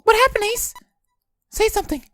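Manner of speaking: put-on high, frightened girl. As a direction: shake him awake, scared. Voices June.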